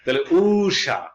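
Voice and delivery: ঊষা said slowly with a long u. Shown as a demonstration. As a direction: pull out the u in ঊষা. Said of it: "দীর্ঘ মানে লম্বা টান".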